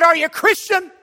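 loud, high shouted question; aggressive challenge. Retold or imitated. Preacher confronts man at the bus stop, aggressive mock shout. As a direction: fire the question like a weapon. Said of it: "I pointed it like it was an Uzi".

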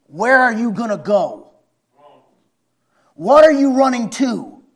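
high, loud fiery preaching; preaching shout. Acted. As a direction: shout the warning to the congregation. Preaching at full force.

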